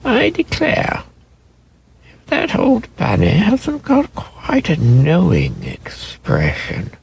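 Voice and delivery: a nurse marvelling at the toy; high fond exclamation, slow; Nana surprised. A narrator's reading.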